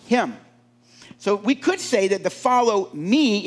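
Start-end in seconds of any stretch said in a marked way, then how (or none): 2.3-3.5 "me" stretched and stressed. Shown as a demonstration. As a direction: put the weight on "me", slowly. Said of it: "is the emphasis on the me".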